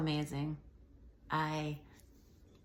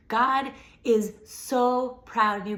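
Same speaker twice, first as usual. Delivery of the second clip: louder, higher, slower insistence; swelling blessing, louder and slower; emphatic; 5 semitones higher, 8 dB louder, slower than usual. Real, not acted. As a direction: lean hard into every word. Repeating the blessing, building.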